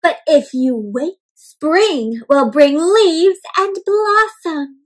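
storybook reading. A narrator's reading. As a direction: draw it out like a gentle promise. Teacher reads book aloud.

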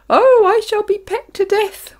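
high, loud terrified wail. A narrator's reading. Earthworm wails in fear of the seagulls, high and loud. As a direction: wail in panic. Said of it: "wailed the poor earthworm".

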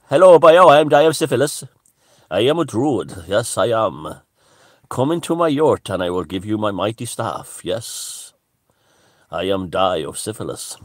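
loud, high Welsh accent, hammed up; a pompous Welsh druid inviting you in; mock Welsh druid. Vlogger voicing a cosplay Welsh druid luring people to his yurt, Welsh accent. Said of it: "would be a Welsh guy".

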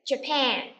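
"Japan" modelled as ja-PAN; "Japan" with a strong falling second syllable; stress on second syllable. Shown as a demonstration. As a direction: lengthen and lean on the end of "Japan". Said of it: "chúng ta nhấn vần thứ hai".

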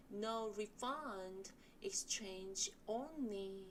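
slow, spaced reading of the sign. Shown as a demonstration. Teacher reading the exchange-only sign for students. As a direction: read each phrase slowly for repetition. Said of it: "같이 한번 읽어보실게요".

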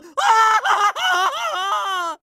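hammed-up triumphant cackle; long gloating villain laugh; evil villain laugh. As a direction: cackle like a cartoon bad guy. Villain gloats over plan.

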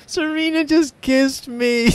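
put-on high, slow, tearful kid voice; high, slow mock child's wail; crying child complaint. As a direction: wail it like a hurt little boy. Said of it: "He's like".

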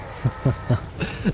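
quick breathy chuckle; a short airy laugh; soft laugh. Real, not acted. Laugh between two answers.

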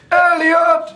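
calling out. Acted. Sophie's worry voiced, crying out for her son. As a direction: call the lost son's name into the dark.

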